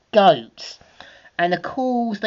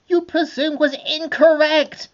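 much higher, curt villainess rebuke; Cruella retort; 13 semitones higher than usual. Acted. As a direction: cut him down sharply.

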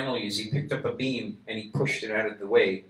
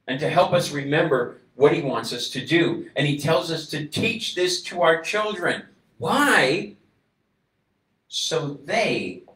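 louder, slow, rising sermon; preaching; 5 semitones higher, 7 dB louder than usual. Acted. Preacher exhorting the congregation, building to full force.

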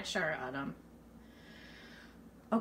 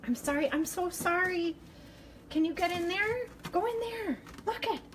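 high, sweet coaxing voice; high-pitched pleading; 8 semitones higher than usual. Real, not acted. Woman apologising to mouse, coaxing it in, high-pitched. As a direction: apologise to a tiny animal up high.

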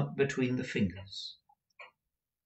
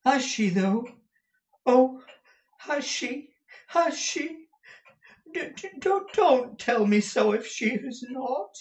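very high, stammering, pleading voice; breathless high-pitched hope; Smike; 13 semitones higher, 3 dB louder than usual. A narrator's reading. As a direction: beg for the truth, high and halting. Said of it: "said Smike".

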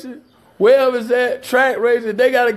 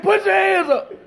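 high, pitched-up police order; mock cop command; 9 semitones higher than usual. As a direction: snap the order like the arresting cop. Officer's command retold.

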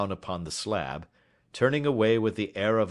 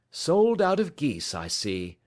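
higher-pitched, conversational Holmes; lightly voiced Sherlock Holmes; 8 semitones higher, 5 dB louder than usual. A narrator's reading. Holmes remarking to a poultry salesman, lightly voiced. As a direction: open high, then drop to an easy aside.

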